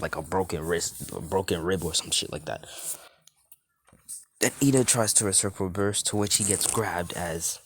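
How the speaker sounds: usual speaking voice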